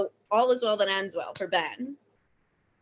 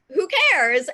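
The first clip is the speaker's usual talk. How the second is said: loud, raised-pitch retort; mock a careless shrug, louder and higher; dismissive; 4 semitones higher, 7 dB louder than usual. Acting out the man's dismissive reply.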